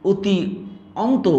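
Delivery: অতি and অন্ত said apart with pauses; split unjoined form. Shown as a demonstration. Teacher demos the unjoined অতি অন্ত. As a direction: separate অতি from অন্ত, slowly. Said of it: "এই অতি অন্তটাকে যদি আমি অত্যন্ত বলি".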